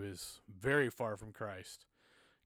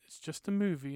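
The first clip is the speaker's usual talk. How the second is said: lightly voiced wife brushing it off; dismissive wife; 5 semitones higher than usual. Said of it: "she's like".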